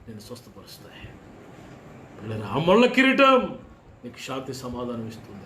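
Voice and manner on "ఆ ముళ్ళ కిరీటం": high, loud and slow, every word weighed; slow preached proclamation. Acted. Pastor proclaiming the crucified Christ, high, loud and slow.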